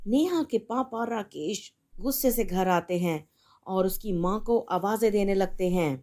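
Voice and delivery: usual speaking voice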